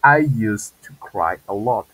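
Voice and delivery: slow, clear model answer. Shown as a demonstration. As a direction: spell it out slowly for the class. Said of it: "Dice Luis".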